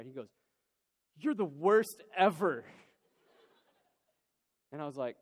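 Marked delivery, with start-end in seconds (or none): 1.2-2.7 slow, louder mock-wounded kid voice; imitating a sulking child's outburst; upset child. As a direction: accuse like a hurt little boy, drawn out. Acted-out crying son accusing dad.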